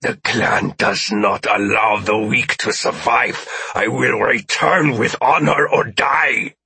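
slow, forceful alien voice; alien's defiant vow. Acted. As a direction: pronounce it as a proud alien warrior. Alien refuses her plea, vows defiance.

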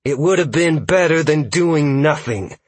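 voiced hiss, heated and high; angry hiss. A narrator's reading. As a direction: throw it back at the scout, cross. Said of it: "Freya hissed".

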